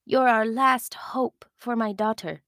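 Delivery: desperate whisper; whispered plea. Acted. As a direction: whisper your last hope. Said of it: "Olina's voice whispered".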